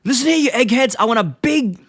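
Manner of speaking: high-pitched imitation of bossy yelling; screaming orders. Homer making demands of the engineers, mock screamed orders. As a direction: bark demands in a hammed-up high voice. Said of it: "just screaming his orders".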